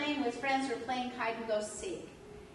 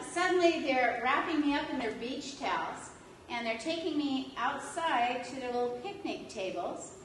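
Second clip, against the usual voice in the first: usual speaking voice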